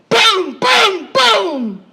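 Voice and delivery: loud high imitated gun blasts; shouted gunshot booms. Storyteller voicing man's gun firing, loud booms. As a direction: bark out each shot like a gun.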